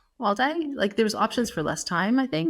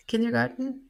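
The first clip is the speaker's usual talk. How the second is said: kindergarten in a German accent, higher, tentative; unsure attempt at a German accent on kindergarten; 6 semitones higher than usual. Shown as a demonstration. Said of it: "How do I say kindergarten in a German accent?".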